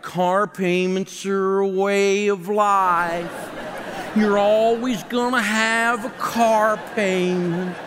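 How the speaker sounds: slow, drawn-out whiny complaint; whining. Retold or imitated. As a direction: moan it like a defeated victim. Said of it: "they're always kind of whining".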